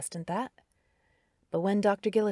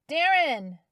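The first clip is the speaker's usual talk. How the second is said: loud, higher call across the woods; 9 semitones higher, 8 dB louder than usual. A narrator's reading. Carrie calling for Darren in the snowy woods, loud call. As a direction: shout his name through the trees. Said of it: "she called again".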